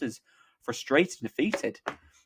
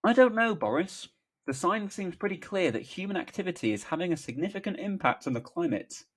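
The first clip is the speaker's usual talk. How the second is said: high, hesitant politician voice; put-on tentative voice, raised pitch; hesitant Keir; 8 semitones higher than usual. A narrator's reading. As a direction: venture a doubtful objection. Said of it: "Keir, hesitantly".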